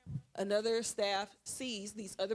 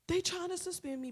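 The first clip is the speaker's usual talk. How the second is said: high-pitched mock-tearful complaint; put-on crying voice, high; imitated crying; 8 semitones higher than usual. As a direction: wail it like an upset schoolboy. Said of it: "he's on the ground crying".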